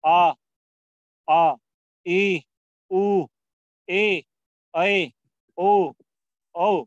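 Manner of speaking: दीर्घ स्वर खींचकर; drawn-out long vowels. Shown as a demonstration. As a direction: stretch each vowel like a drill. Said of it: "ये आपके दीर्घ स्वर हैं".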